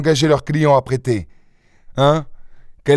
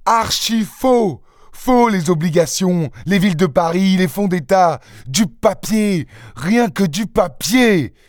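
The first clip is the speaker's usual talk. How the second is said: higher, slow, hammering each word; slow outraged rant; 6 semitones higher than usual. Acted. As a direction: pound out each item in disgust. Lupin raging over fake titles. (fureur lente et martelée)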